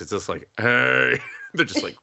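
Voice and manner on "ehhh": mimicked bleat of an odd animal; imitated creature noise. Showing a friend the noise it makes, imitated animal cry. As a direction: make the water deer's silly noise. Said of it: "what's great is the noise it makes".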